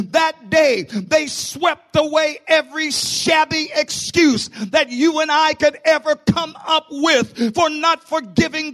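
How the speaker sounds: loud, slow, hammering sermon delivery; full-force preaching. Acted. Pastor preaching to his church, full force. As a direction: preach it to the rafters.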